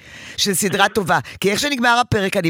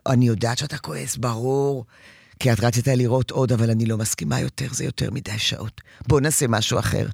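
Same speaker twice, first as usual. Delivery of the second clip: steady and gentle, no anger at all; calm reassurance; 6 semitones lower than usual. Retold or imitated. Mother calmly answering angry child. (רגוע ומרגיע)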